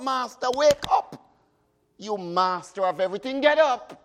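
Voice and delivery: frightened disciples. Acted. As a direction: cry out to the sleeping Master.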